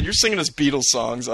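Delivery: ordinary talk, nothing marked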